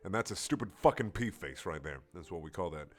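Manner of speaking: normal talking voice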